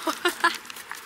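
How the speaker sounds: breathy high chuckle; short, soft laugh at own joke. Real, not acted. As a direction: let out a quiet high giggle. Jokes they are like thieves.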